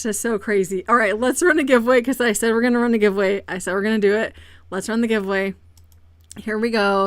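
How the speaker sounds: ordinary talk, nothing marked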